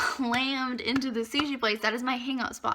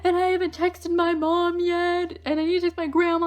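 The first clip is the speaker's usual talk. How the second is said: high, loud mock wail; hammed-up whiny panic, pitched up; imitated near-tears panic; 7 semitones higher, 5 dB louder than usual. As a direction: play a friend freaking out on the verge of tears. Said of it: "She's on the verge of tears".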